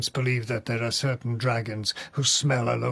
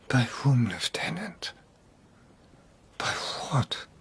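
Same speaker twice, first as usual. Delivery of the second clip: whispered. Acted. Lord imagines wife's murder.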